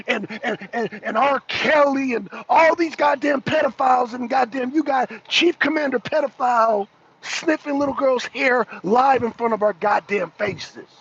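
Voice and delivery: ordinary delivery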